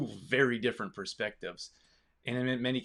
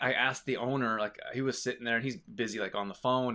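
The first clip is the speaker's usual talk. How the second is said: usual speaking voice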